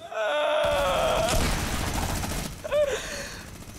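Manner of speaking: long high wheezing laugh; breathless laugh. Real, not acted. Streamer cracking up at his own crash, wheezing laugh. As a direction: laugh until the breath runs out. Laughing after plane death.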